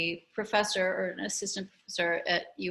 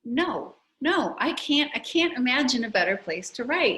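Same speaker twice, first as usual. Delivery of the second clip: excited realization; 6 semitones higher, 6 dB louder than usual. Retold or imitated. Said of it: "And I thought".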